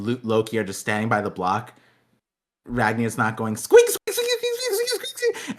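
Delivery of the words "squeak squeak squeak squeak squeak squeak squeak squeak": very high rubbery squeaks; squeaky toy voice. Retold or imitated. Ragnar imitated squeaking.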